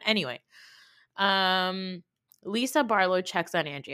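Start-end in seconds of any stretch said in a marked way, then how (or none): none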